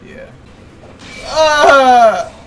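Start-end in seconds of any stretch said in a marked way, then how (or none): 1.2-2.3 startled yell. Real, not acted. Hit while fleeing.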